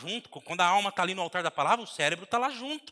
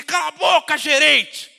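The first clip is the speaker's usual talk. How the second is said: angry shout; 6 semitones higher, 11 dB louder than usual. Retold or imitated. Boss shouting down his manager in anger. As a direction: yell it like a bad boss, much louder and higher.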